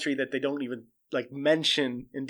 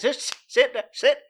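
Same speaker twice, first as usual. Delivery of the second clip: much higher, louder, barked commands like a trainer; tiger trainer commands; 10 semitones higher, 3 dB louder than usual. Retold or imitated. Joe commanding the husband like his tigers, higher and louder. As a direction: order him around like an animal. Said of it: "like he trains his tigers".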